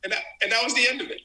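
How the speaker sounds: laughing. Real, not acted. Still laughing at the story.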